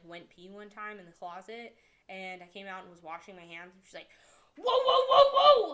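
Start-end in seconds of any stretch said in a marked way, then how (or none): 4.6-5.7 very high, loud string of whoas; imitated alarmed yell. Boss catching worker washing hands, loud mock-panicked yell. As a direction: mimic a boss frantically halting someone.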